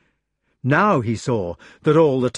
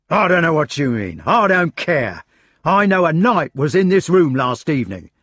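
agitated; 4 semitones higher, 5 dB louder than usual. A narrator's reading. Meleagrance ranting at Lancelot.

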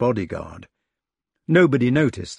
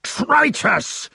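high sudden cry of betrayal; a knight crying out in high shock. A narrator's reading. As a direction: cry out as if struck. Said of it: "cried Sir Meleagrance suddenly".

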